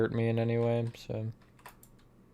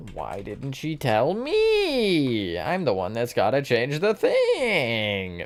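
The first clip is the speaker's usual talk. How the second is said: long drawn-out, high-pitched wail of complaint; hammed-up aggrieved moan, swooping up high; mock whining; 4 semitones higher, much slower than usual. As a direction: whine it slowly, stretching the last words. Mock complaint during the game.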